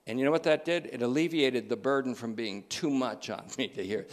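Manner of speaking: ordinary talk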